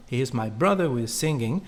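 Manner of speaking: ordinary talk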